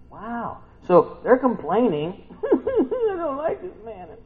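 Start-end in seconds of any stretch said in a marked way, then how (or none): 2.4-4.2 high, slow whiny mock complaint; mock the grumbling Israelites in a high whine; whiny complainer. Preacher mocks the Israelites grumbling about manna, high whiny voice.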